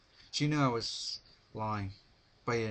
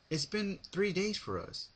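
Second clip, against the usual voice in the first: smug; 10 semitones higher than usual. A narrator's reading. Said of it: "she said, a bit smugly".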